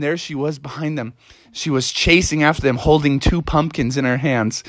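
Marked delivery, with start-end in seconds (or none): none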